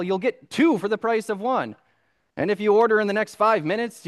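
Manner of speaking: loud, high, pushy TV salesman; infomercial pitchman. Retold or imitated. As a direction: sell it like a late-night ad. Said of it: "the annoying guy that talks too loud".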